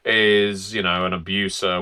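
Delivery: normal talking voice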